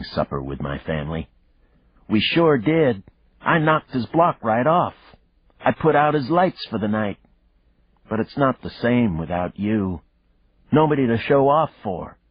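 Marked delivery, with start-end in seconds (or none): 2.1-12.2 put-on high, proud child voice; little girl voice. A narrator's reading. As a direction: boast like an excited kid. Daughter's voice on phone.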